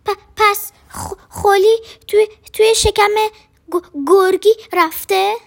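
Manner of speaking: terrified stutter; stammering in fear. A narrator's reading. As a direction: stammer it out scared. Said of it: "به تیت پیت افتاد".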